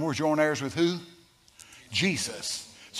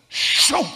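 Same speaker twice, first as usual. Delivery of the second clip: loud, sudden "shoom" sound effect; imitated whoosh; 12 semitones higher, 8 dB louder than usual. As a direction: burst out a high whooshing noise. Imitates the wall opening suddenly.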